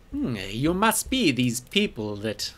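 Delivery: a smooth, scheming merchant greeting customers; sly shopkeeper voice. Acted. Shopkeeper greeting the newly arrived party, in a sly merchant voice.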